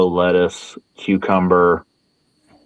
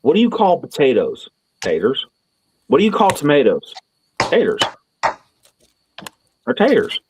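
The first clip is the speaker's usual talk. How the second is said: higher, quizzing manager voice; mocking the manager; 6 semitones higher than usual. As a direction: play the exasperated boss drilling him. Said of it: "and he's like".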